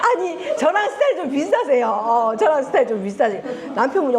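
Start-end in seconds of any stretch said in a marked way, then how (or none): 0.0-1.9 said high through laughter; laughing. Real, not acted. Lecturer teases an audience member, laughing. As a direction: laugh the line out, tickled. Repeats remark, first laughing.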